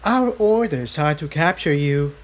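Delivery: a villain stating orders, lightly voiced; menacing unicorn henchman, lightly voiced; gray unicorn henchman. A narrator's reading. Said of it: "the gray unicorn replied".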